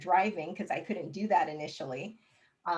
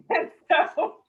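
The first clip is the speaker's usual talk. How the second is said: laughing; 11 semitones higher, 11 dB louder than usual. Real, not acted. Still laughing at the joke.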